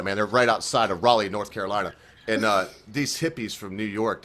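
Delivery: usual speaking voice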